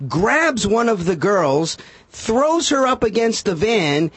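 usual speaking voice

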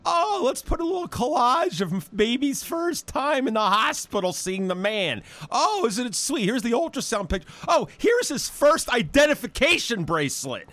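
high, gushing mock-cute voice; mock sweet gushing. Mocking the baby store's sentimental frames in a high gushing voice. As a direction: sneer at the store's cute keepsakes, sing-song. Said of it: "sold in the store like".

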